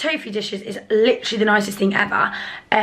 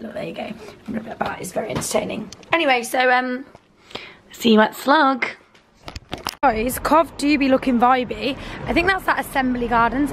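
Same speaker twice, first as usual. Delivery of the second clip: ordinary talk, nothing marked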